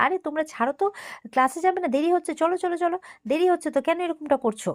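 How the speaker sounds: high, rising irritation, partly retold; getting annoyed. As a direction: hurry the children off, losing patience. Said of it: "রেগে যাচ্ছে আস্তে আস্তে".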